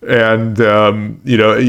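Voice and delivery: ordinary delivery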